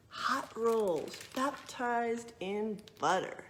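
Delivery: lively storybook narration; reading to children. A narrator's reading. Librarian reading a true story to children, lively narration. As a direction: read it out like a picture book for kids.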